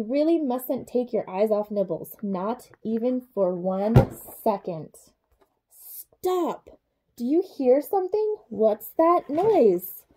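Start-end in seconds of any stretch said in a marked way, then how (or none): 5.7-10.1 high, hushed then slow alarm; mock alarm. A narrator's reading. Narrator making children listen for a noise, mock alarm. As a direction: freeze and listen. Suspense at ending.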